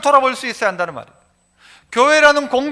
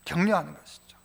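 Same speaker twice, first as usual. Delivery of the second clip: ordinary delivery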